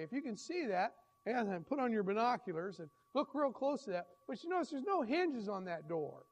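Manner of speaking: ordinary talk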